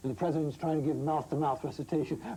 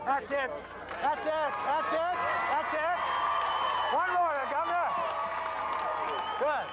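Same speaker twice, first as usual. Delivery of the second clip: calling out; 10 semitones higher than usual. Real, not acted. Photographer calling out at shoot.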